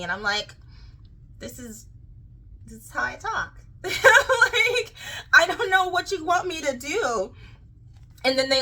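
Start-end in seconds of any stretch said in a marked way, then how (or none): 1.4-3.5 soft, halting self-defence; subdued retold reply. Retelling her subdued reply when criticised. 3.8-7.3 high, laughing retold protest; laughing helplessness. Laughs while retelling her helpless reply.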